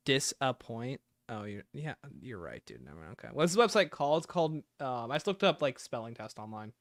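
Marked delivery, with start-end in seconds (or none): none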